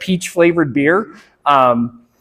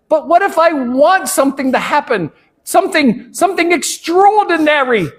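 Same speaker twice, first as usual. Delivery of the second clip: put-on high-pitched New York boy; Russell Jimmy; 8 semitones higher than usual. Boy wanting something to happen, high New York accent. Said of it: "he has a New York accent".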